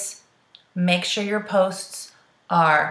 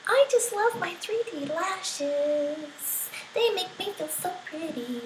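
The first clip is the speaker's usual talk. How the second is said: sweet family voice; 9 semitones higher, 8 dB quieter, slower than usual. Shown as a demonstration. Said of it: "Where you're like".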